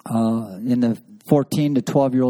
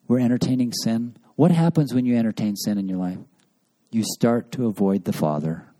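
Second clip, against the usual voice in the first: usual speaking voice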